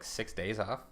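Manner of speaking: ordinary talk, nothing marked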